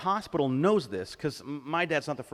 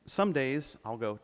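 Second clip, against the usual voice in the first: ordinary talk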